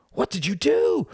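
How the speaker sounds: very high, anguished yell; horrified cry. Acted. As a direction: cry out in shock at the attacker. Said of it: "cried the brother".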